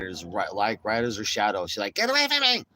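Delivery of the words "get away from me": high, loud mocking scream of an angry woman; hammed-up high-pitched angry yell; aggro screech. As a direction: imitate her aggressively yelling someone off. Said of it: "She's fucking aggro".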